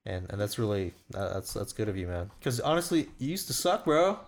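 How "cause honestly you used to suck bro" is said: bro voice. Acted. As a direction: hype it up like a gym bro. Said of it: "That's my bro voice".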